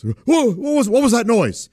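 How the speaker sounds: high-pitched pretend panic; mock terror. Uncle teasing niece about fireworks, mock terror. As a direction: imitate playing scared for a small child. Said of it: "pretending to be terrified of the fireworks".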